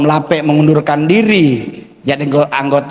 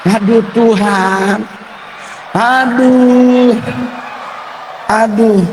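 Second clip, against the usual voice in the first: mock groan; 6 semitones higher, slower than usual. Recalls embarrassing koteka moment.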